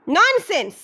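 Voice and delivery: a sharp dismissive roar. A narrator's reading. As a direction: throw the word back at him with contempt. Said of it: "গর্জিয়ে উঠলেন detective".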